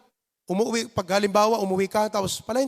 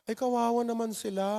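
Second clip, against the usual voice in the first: pitying; 8 dB quieter, faster than usual. Retold or imitated. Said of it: "Yung iba naman".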